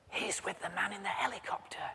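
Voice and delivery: unvoiced little boy whisper. Boy whispers answers to the puzzled boss. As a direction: barely breathe the words.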